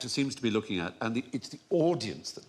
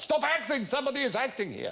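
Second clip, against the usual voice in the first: high-pitched director's shout, mock exasperation; hammed-up high yell; Milos Forman shouting. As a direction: imitate a director yelling across the set. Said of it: "Milos Forman famously shouts".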